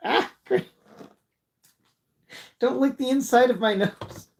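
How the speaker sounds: high, squeaky protest; startled by cat. Real, not acted. Owner protesting as the cat licks his nose, high yelp.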